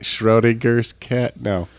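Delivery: ordinary talk, nothing marked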